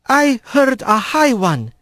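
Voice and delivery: gentle halting. Acted. Careful halting foreign speech.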